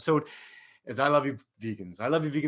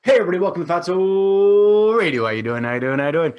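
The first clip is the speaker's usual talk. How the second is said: loud, high announcer welcome; radio host intro; 7 semitones higher, 11 dB louder than usual. Acted. Host restarting the show with a loud announcer greeting.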